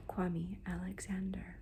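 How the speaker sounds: ordinary delivery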